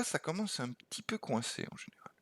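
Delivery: soft hushed whisper; whispered aside. Real, not acted. Teacher to students on the puzzle, hushed whisper. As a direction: let the difficulty slip out in a whisper.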